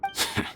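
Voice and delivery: short high scornful laugh; mocking laugh. Villain laughing off the nurse's offer, scornful. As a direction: scoff at the idea.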